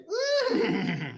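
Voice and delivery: very high, drawn-out hype squeal; excited high-pitched trill. Real, not acted. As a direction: let out a long high whoop. Whooping after hype call.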